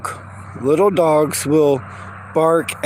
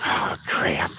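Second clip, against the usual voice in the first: hushed curse; 11 semitones lower, 6 dB quieter than usual. Real, not acted. Dogs barking, hushed.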